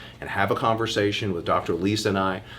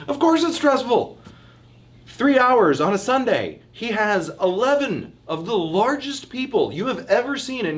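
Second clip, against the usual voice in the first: excited; 11 semitones higher than usual. Real, not acted. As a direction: pile on the drama with excitement. Animated football stress description.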